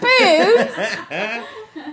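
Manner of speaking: loud, high burst of laughter. Real, not acted. Big laugh.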